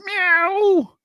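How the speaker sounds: high mock meow; cat meow. Cat in the joke.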